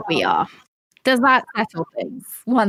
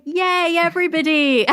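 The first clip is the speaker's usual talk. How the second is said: high-pitched, over-eager cheer; mock cheering; 10 semitones higher than usual. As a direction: cheer like you want everyone to like you. Mocks British eagerness to be liked.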